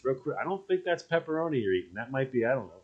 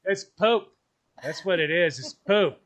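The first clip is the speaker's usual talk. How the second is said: teasing; 5 semitones higher, 4 dB louder than usual. Real, not acted. Teasing about dog poop.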